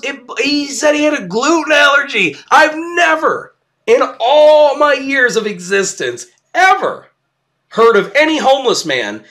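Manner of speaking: can't believe what you're hearing; incredulous. Real, not acted. Disbelief at homeless man's allergy.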